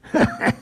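short throaty chuckle; creature's scoffing laugh. A narrator's reading.

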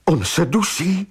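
high-pitched mildly desperate cry; desperate exclamation. A narrator's reading. Maggie fears the bound man is choking, desperate cry. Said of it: "vykřikla zoufale Maggie".

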